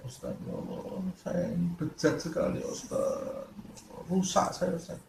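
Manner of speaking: whispered, tearful plea to the teacher; sobbing confession. Retold or imitated. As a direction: act out the weeping sinner, hushed and broken. Jemaah mengadu sambil menangis. (pengakuan berbisik sambil menangis)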